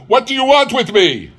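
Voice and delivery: high, loud frightened demand; alarmed demand. Acted. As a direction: fling the question at the ghost. Scrooge confronts the ghost.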